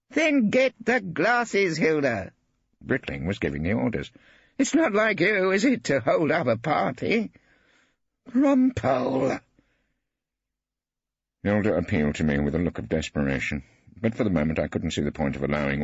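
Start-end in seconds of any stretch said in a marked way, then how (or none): 0.1-9.4 put-on high, slow, commanding old artist; Brittling giving orders. A narrator's reading. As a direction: order her about with relish.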